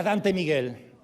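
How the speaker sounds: usual speaking voice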